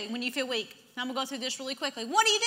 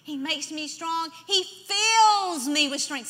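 loud, raised, drawn-out declaration; emphatic proclamation; 3 semitones higher, 4 dB louder, much slower than usual. Acted. Preacher affirming God's strength, emphatic and slow. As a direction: stretch each word with conviction.

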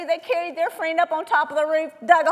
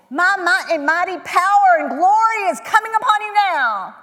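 louder, booming fake divine voice; mock grandiose proclamation; 3 semitones higher, 8 dB louder than usual. Preacher mocking what Jesus did not say, booming and grandiose. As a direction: ham up an overblown almighty speech.

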